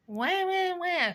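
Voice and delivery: high, loud mocking wah-wah crying; hammed-up baby-cry mockery of complaining; mock whiny wah. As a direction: whine it out like a fake crybaby.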